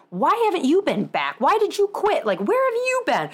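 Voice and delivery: hammed-up pleading patient going up and up; mock whiny patient. Imitating patients asking why she quit.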